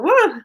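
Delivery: high, loud "woo"; big delighted cheer; excited whoop. Real, not acted. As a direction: whoop it up in celebration. Guest celebrates being on the show.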